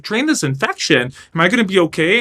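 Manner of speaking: worried patient suddenly scared; alarmed. Retold or imitated. Panicked question to doctor.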